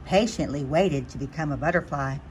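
usual speaking voice

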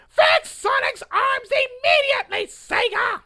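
a high, loud shouted demand; furious protester shouting at the company. Acted. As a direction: yell it like a rally chant.

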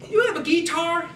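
high, loud yokel question; Cletus excited. Acted. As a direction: squeal it out high, thrilled. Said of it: "I'm Cletus".

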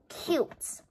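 breathy whispered cute; hushed little aside. Real, not acted. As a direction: whisper it to yourself in awe. Admiring accessory.

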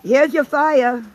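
excited. Acted. Showing preloaded fire filter.

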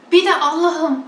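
imitating a preacher's trembling emotional voice; mock pious quaver. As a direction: ham up a tearful plea. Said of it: "duygusal ses tonuna geçiyor".

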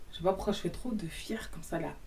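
whispered aside to herself. Real, not acted. Teasing herself for showing off, whispered aside. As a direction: mutter it under your breath, whispered.

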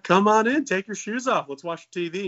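high, loud come-on-in; eager mock invitation. Joker inviting the mail lady in, high eager welcome.

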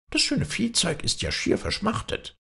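high, sly goblin voice; cunning high goblin. A narrator's reading. Kobold voice in fairy tale.

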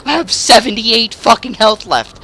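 high-pitched, agitated protest; frantic exasperation. Real, not acted. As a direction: complain in high, rattled disbelief. Dying right after respawn.

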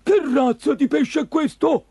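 loud, high monster-fisherman voice; baffled fisherman. Acted. Green fisherman puzzling over the puppet, loud and baffled. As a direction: exclaim in astonishment at a strange find.

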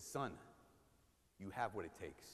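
low, soft, gentle blessing to a son; a father softly telling his son he has what it takes; tender fatherly blessing. Retold or imitated. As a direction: bless him like a proud father.

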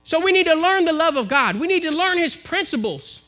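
impassioned sermon climax; preaching at full force. Acted. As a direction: drive the message home to the congregation.

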